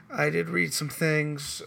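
ordinary delivery

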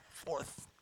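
a whispered correction to his wife; whispered aside. Real, not acted. Pastor whispering to his wife about her rank, playful. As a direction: breathe it as a private aside.

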